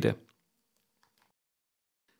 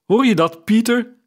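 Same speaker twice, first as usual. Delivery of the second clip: high, louder motherly exclamation; excited calling; 11 semitones higher, 6 dB louder than usual. A narrator's reading. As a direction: call to your son, pitched well up. Mother teasingly calls her son. (opgewonden, hoog uitgeroepen)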